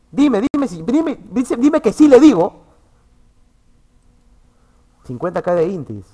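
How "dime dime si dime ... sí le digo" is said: high, loud, repeated demand; insistent demand. Real, not acted. Streamer challenging someone to say so, loud insistent demand. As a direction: push louder and higher to the last word. Heated retort about asking someone.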